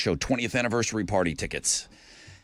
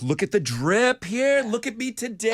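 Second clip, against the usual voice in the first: put-on cocky kid voice; 7 semitones higher than usual. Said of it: "And he's like".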